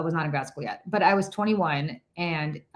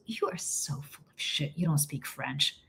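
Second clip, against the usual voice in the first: a low, soft, slower scoff; scoffing friend; 3 semitones lower, 10 dB quieter, slower than usual. Retold or imitated. Friend John scoffing at the narrator's French, quiet and doubtful. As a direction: mutter it like you can't believe a word. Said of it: "he looks at me and he goes".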